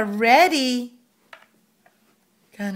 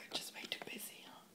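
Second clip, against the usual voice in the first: whispering; 25 dB quieter than usual. Real, not acted. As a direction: breathe it as a whisper. Whispering to busy toddler.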